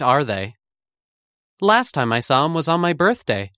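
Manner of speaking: ordinary talk, nothing marked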